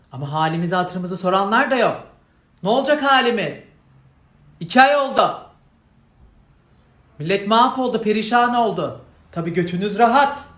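outraged shouting tirade; angry rant. Real, not acted. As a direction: let them have it, loud and high. Furious rant about hardship.

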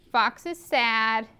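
ordinary delivery

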